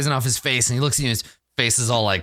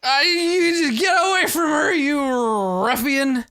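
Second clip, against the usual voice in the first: put-on high, slurred drunken shout; drunk Skraldar. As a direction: drag the words out like an indignant drunk. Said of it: "he's super drunk".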